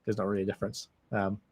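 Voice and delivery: normal talking voice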